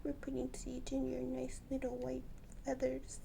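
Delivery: soft whispered comfort; tender whisper. Real, not acted. Owner comforting her bird covered in seeds, whispered.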